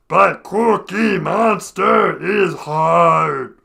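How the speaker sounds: slow, loud monster growl; Cookie Monster voice. Shown as a demonstration. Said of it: "to do Cookie Monster".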